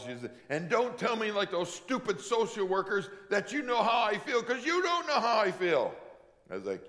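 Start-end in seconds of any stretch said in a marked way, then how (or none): 0.5-5.9 higher, louder bitter warning; mock angry girl; scowling defiance. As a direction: snap at him like a hurt teen. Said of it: "her face scowls".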